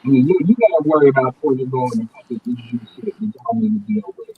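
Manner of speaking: ordinary talk, nothing marked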